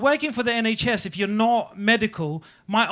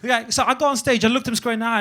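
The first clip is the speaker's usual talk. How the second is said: ordinary talk, nothing marked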